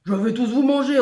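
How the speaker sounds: loud, high monster threat; big booming threat; threatening monster. A narrator's reading. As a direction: roar it at a crowd to terrify them. Monstre menace les villageois.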